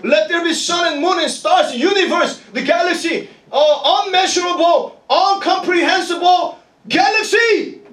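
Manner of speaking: high, mounting proclamation of creation; God's command. Acted. Preacher voicing the Creator making the universe, God's command. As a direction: build through the list as a preacher proclaims. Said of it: "He's the one who said".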